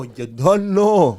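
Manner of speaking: slow drawn-out Toronto slang; Toronto slang accent. Shown as a demonstration. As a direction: stretch it out, Toronto style. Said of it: "instead of saying".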